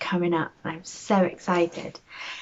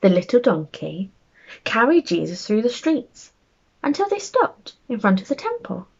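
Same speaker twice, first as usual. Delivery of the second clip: lively, animated storybook narration, rising higher as the crowd grows; bedtime story reading; 3 semitones higher than usual. A narrator's reading. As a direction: read it like a picture book to small children. Reads a bedtime story aloud.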